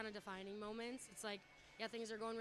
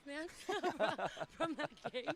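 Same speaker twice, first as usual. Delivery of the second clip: breathless laugh, louder and higher; 5 semitones higher, 8 dB louder than usual. Real, not acted. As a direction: laugh through the words. Laughing while catching breath.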